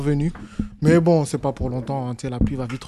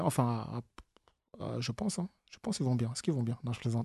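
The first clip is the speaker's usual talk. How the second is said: quiet mock aside; 3 semitones lower, 11 dB quieter than usual. Mock worried aside, then joke.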